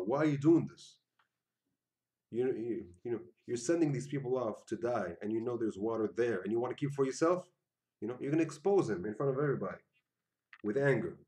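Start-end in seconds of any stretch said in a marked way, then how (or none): 0.0-7.5 louder, demanding accusation; angry confrontation. Pretend. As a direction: confront him hard in front of the crowd. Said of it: "just to show him how angry you are".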